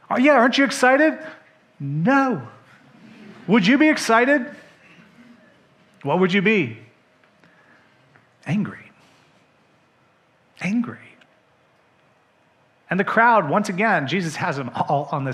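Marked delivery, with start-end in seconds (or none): 0.1-1.2 high, loud and cheery; chirpy servant. Acted. Servant teasing brother. 8.4-11.0 whispered answer. Real, not acted. Answers his own question.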